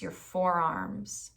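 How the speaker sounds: calm body-scan voice with long pauses; guided meditation. Acted. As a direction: guide them gently through the body, unhurried.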